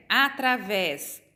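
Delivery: "através" bem devagar, com a última sílaba forte; tônica em ATRAVÉS. Shown as a demonstration. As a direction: alongue "através", destacando o "vés" final. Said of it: "é a última sílaba mais forte". ("através" esticado, última sílaba tônica)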